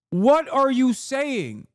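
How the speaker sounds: high, drawn-out incredulous demand; exasperated disbelief. Real, not acted. Streamer challenging Jeffries on Qatar claim, exasperated disbelief. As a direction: stretch every word in exasperation. Arguing with absent politician.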